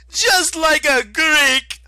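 shrill drawn-out scornful yell. Acted. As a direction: bellow the insult with contempt. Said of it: "Octavian yelled".

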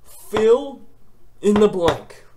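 outraged emphasis. Retold or imitated. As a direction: spit each word out in outrage. Repeating in outrage.

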